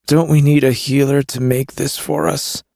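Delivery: forced through gritted teeth; strained, teeth clenched. A narrator's reading. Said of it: "he gritted out".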